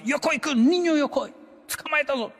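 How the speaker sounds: high, loud playful call; teacher grabbing student. Retold or imitated. Teacher catching the boy in the hall, high loud playful call. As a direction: pounce on the kid with a big high cry. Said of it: "lo agarraba uno de".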